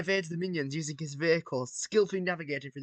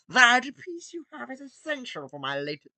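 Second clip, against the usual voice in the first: put-on higher, louder villain accent; Gru impression; 8 semitones higher, 6 dB louder than usual. Villain demanding Emmet's piece, hammed accent. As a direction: lean into a thick supervillain accent. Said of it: "I can't do his voice".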